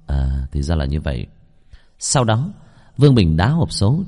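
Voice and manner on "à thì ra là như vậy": low, subdued acknowledgement; deep voice. Acted. Vương Bình answers the crying girl, in a deep low voice. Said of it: "gật đầu trầm giọng".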